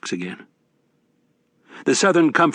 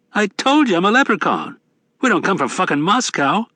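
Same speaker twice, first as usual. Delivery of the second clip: higher, brash Irish barfly; rowdy leprechaun; 9 semitones higher than usual. A narrator's reading. Mad Sweeney voiced in narration.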